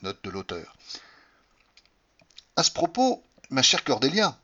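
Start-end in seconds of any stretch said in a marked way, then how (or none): 2.5-4.4 a touch of Patrick's affected manner; a hint of a fond English gentleman; Patrick's phrase. A narrator's reading. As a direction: lightly mimic his pet phrase. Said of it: "ce que je pouvais souffrir en l'entendant dire".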